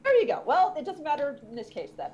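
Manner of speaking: ordinary talk, nothing marked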